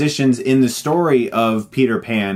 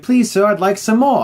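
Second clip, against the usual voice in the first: Oliver Twist orphan plea; 6 semitones higher than usual. Retold or imitated. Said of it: "he's like".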